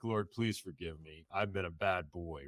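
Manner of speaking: softly asking God for forgiveness; quiet prayer. Retold or imitated. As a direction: murmur it like a last prayer. Praying quietly before maybe dying.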